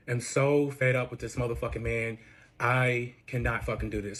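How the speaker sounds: slow, low, worn-out retelling; fed up. Retold or imitated. Hurt on the floor after the fight, fed up. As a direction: drag the words out low, at the end of patience. Retold breaking point after broken ankle.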